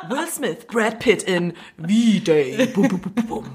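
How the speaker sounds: slow, drawn-out movie-trailer parody; mock trailer announcer. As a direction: announce a doomsday blockbuster, half laughing. Spoof film trailer for V-Day.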